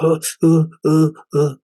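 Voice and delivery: high, loud stuttered uh uh, shaken; shaky stammer. Retold or imitated. Just out of the office after confronting the manager, shaky stammer. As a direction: stammer out of nerves, voice rattled. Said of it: "even though I was shaking".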